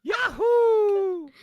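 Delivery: high, loud falsetto whoop; very high cartoon cheer; Mario. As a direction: whoop like a bouncy game hero.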